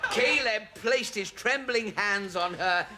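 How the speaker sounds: high, dramatic novel reading; breathless pulp-romance relish, pitched high; excited story narration. Acted. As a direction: read the steamy climax with rising excitement. Gets carried away reading.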